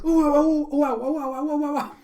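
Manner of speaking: very high, loud stream of wows; hammed-up child overcome with amazement; excited freak-out. Child freaking out at the cruise ship, excited squealing. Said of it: "She's just freaking out".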